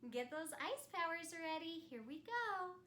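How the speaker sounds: ordinary delivery, nothing marked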